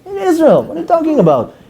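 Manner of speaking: high scoffing disbelief; incredulous. Retold or imitated. Mocking Israelis scared of army guards, high incredulous scoff. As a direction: scoff at the very idea.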